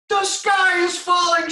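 very high loud slow peep; panicked peep. Acted. Reading aloud, the smallest chicken panics at the acorn. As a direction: shriek the alarm like a tiny chick. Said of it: "she peeped".

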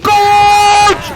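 high, loud yell to flee; panicked scream. Real, not acted. Player fleeing a monster, panicked scream. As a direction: scream it as the thing grabs you.

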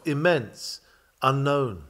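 ordinary delivery

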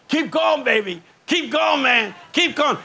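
high cheering call of keep going; church men yelling. Retold or imitated. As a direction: urge the preacher on, voice leaping up. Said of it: "point at you and yell".

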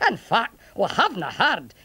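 lilting Scots burr; chatty old Scots soldier, pitch swooping; Scottish centurion, explaining. Acted. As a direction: explain matters in a Scottish accent.

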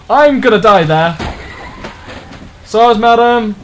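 loud, high call from the wheel; alarmed calling out. Real, not acted. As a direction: call out loudly to other drivers. Calls out while driving.

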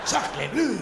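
hammed-up French outburst; mock French exclamation. Fake French exclamation to dancer.

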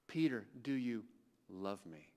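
hushed third asking. Retold or imitated. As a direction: let the question sink to a hush.

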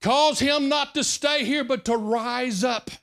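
yelled prayer. Retold or imitated. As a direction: belt out the retold prayer, drawing out the words. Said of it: "he wasn't yelling like that".

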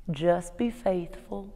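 slow, soft Southern drawl, lightly imitated. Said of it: "he said in his southern drawl".

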